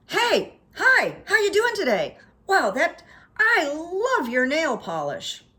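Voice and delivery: high, loud, gushing greeting; bubbly barista. Retold or imitated. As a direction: gush like an overeager barista. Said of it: "full of energy and goodwill".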